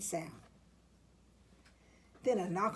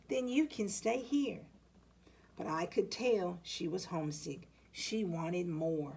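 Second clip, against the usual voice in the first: ordinary delivery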